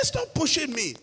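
high-pitched retold angry protest; heated, upset protest in a retelling. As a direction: act out telling someone off, voice pitched high. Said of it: "I got angry and upset".

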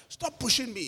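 acted annoyance without shouting; angry and upset. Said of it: "I got angry and upset".

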